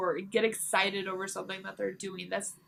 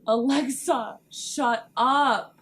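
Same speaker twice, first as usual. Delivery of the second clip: gasp, then a louder startled shout at the speaker; startled at Alexa; 3 semitones higher, 7 dB louder, much slower than usual. Real, not acted. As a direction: snap at the device after a gasp. Alexa interrupts her.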